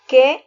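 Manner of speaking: "¿Qué?" said with force on the accented e; stressed qué. Shown as a demonstration. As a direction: hit "¿Qué?" hard to show the accent on the e. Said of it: "lleva acento en la E".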